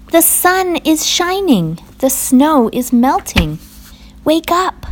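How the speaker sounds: high, urging Frog voice; crying out. A narrator's reading. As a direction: coax a sleeper up in a high, carrying voice. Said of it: "cried Frog".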